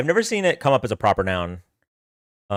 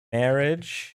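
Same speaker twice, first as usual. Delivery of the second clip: ordinary delivery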